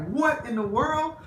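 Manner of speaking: high, incredulous exclamation; a kid floored by what he heard; high-pitched childish disbelief; stunned child. Retold or imitated. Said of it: "you'd be like".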